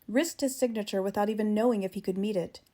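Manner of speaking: ordinary delivery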